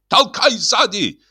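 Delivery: very high, drawn-out command; frightful high-pitched yell; terrible-voiced shout. A narrator's reading. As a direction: holler an order to push. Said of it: "закричал Шаринов страшным голосом".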